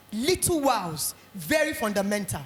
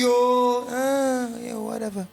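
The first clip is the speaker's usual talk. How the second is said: bored parent; much slower than usual. Retold or imitated. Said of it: "And they were happy".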